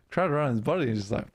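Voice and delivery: ordinary delivery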